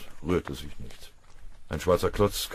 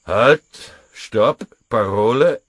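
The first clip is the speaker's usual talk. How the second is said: loud, higher sentry shouts; booming challenge; 7 semitones higher, 15 dB louder, slower than usual. A narrator's reading. Sentry challenges the approaching men, booming shout. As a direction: bark the challenge into the dark. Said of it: "eine raue Stimme dröhnte".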